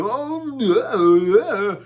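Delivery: a hammed-up high, airy "oh yeah"; mock Sanjay Gupta. Mimicking Sanjay Gupta dodging Rogan, high and mock-casual. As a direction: brush it off in a put-on doctor voice. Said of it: "And Sanjay was like".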